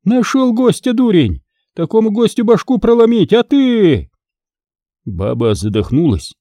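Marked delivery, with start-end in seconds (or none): 0.0-4.1 shrill scolding wife. Acted. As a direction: rail at the monk like a furious peasant wife. Wife berates husband.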